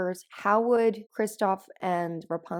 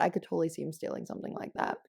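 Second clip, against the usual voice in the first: normal talking voice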